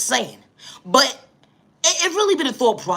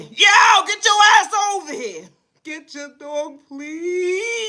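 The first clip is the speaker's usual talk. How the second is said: owner screaming; 6 semitones higher, slower than usual. Retold or imitated. Owner screaming from down the block then pleading. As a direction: bellow it then soften. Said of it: "They screaming within the screaming".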